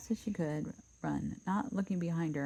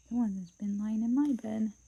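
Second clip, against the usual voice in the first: higher, louder bear complaint; higher mama bear; 5 semitones higher, 4 dB louder than usual. Acted. Mama Bear finding her bed used, higher voice. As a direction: raise it a step for the middle bear.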